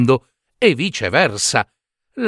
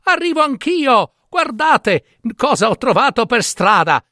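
much higher, eager shout; excited calling out; 11 semitones higher than usual. Acted. Giambabbeo showing his brothers a dead crow, eager high call. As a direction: call ahead, proud of the find.

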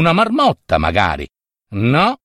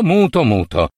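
ordinary delivery, nothing marked

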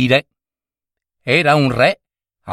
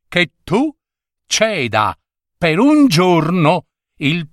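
put-on old wizard; 5 semitones higher than usual. Wizard states his terms.

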